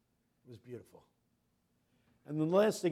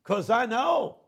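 higher, louder, slow triumphant farewell; joyful preaching shout; 8 semitones higher, 16 dB louder, slower than usual. Retold or imitated. Pastor promising the dead man glory, loud and triumphant. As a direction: swell into a victorious promise.

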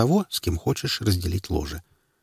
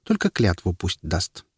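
чуть иной тембр, лёгкий голос феи; сказать как маленькая фея. A narrator's reading. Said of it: "согласилась со мной фея". (голос феи с лёгким тембром)

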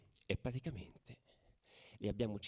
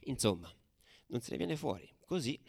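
plain reading voice

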